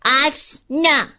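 put-on slow, high, loud child's flat no; sulky slow refusal, high and loud; stubborn little sister. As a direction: drag it out like a pouting kid. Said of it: "فقط گفت".

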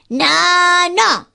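put-on high, loud furious little-girl no; a tantrum, eyes squeezed shut; angry child shout. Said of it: "خیلی عصبانی شد و اونقدر داد زد".

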